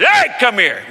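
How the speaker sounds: imitating dad's holler; dad's call. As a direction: call sharply like a father. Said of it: "like my dad".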